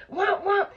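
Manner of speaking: smack it out, wham wham; punch sounds. Retold or imitated. Mimicking punches.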